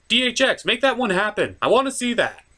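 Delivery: usual speaking voice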